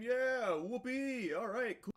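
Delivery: high, loud fist-pump whoopee; mock cheering. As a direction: cheer it like a sarcastic fan. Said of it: "I was pumping my fist saying".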